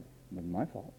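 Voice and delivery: lightly voiced sulky excuse; mock excuse. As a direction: sulk it under your breath. Mimics self-pitying excuse.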